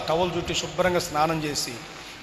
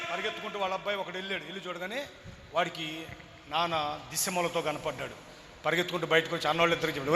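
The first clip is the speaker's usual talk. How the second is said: usual speaking voice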